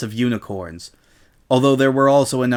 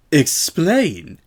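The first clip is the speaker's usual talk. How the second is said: Miss Vinton voice; 4 semitones higher than usual. Acted. Vinton caught off guard.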